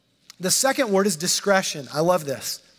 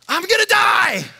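high, loud panicked shout; panicked cry; 10 semitones higher, 5 dB louder than usual. Retold or imitated. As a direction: cry out as if facing death. Panicked crowd's fear quoted.

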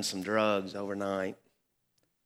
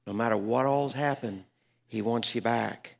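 normal talking voice

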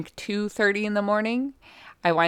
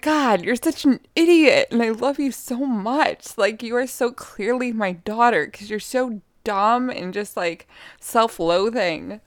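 higher-pitched, affectionate mock scolding; fond exasperation; 4 semitones higher than usual. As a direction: scold a silly pet you adore. Retold fond scolding of her dog.

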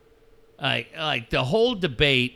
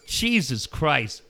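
usual speaking voice

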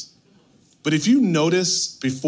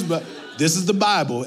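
usual speaking voice

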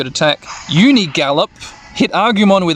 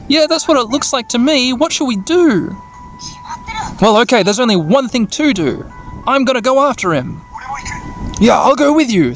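raised, earnest character voices; worried kids' dialogue; 7 semitones higher than usual. Acted. The children decide to go after Juo, in raised earnest voices. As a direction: act out a rescue plan, pitched up.

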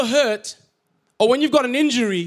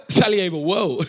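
normal talking voice

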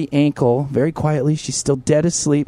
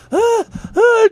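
panicked screams. Retold or imitated. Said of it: "And she's like".

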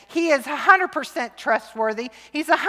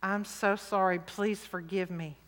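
soft apology; 6 semitones lower, 13 dB quieter than usual. Retold or imitated. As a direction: hush down as if asking your kids' pardon. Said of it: "my kids heard me say".

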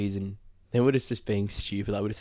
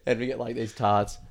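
ordinary talk

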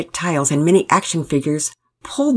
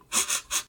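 quiet tearful sniffles; sniffling; 11 dB quieter than usual. A narrator's reading. Pablo settles down on Daddy's lap, tearful sniffling. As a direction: sniff back tears, softly. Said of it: "Through tears and sniffling".

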